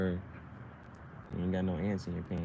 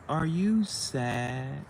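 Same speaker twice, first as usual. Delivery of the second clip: taunting; 6 semitones higher, 3 dB louder, much slower than usual. Acted. Taunting, drawn-out question.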